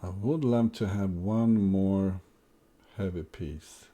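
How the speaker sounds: normal talking voice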